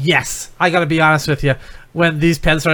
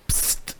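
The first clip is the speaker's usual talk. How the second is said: soft unvoiced psst; whispering a secret password; 13 dB quieter than usual. Shown as a demonstration. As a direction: hiss the password under your breath. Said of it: "The password is just go".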